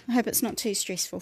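normal talking voice

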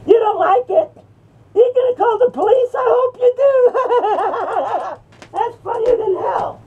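high-pitched taunt breaking into laughter; gleeful taunting. Pretend. Smoker daring Gary to call police, laughing taunt. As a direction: goad him, then crack up.

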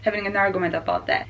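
ordinary talk, nothing marked

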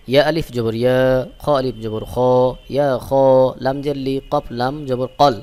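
ordinary talk

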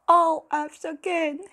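high, loud wail over bad grades. A narrator's reading. Small fairy wailing over her report card. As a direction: wail it out in despair. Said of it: "she wailed".

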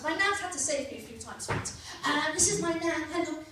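ordinary talk

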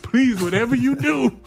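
high, begging please; pleading. Retold or imitated. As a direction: beg the coach not to send you in. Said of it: "And I'm like".

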